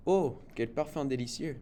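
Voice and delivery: plain reading voice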